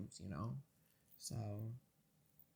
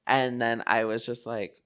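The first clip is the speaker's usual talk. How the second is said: normal talking voice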